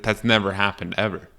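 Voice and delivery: ordinary talk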